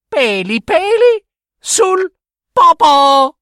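very high loud squeal ending in a laugh; triumphant cheeky giggle; high taunt with loud laughter; shrieking laugh taunt. Acted. Girl's final taunt and laugh.

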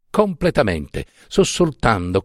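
usual speaking voice

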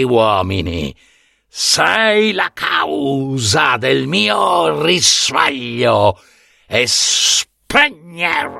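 slow, strange monster voice, swelling to a shout; a vast beast passing its doom on her, slow; the monster Typhon threatening the young goddess, slow and looming; menacing monster. Acted.

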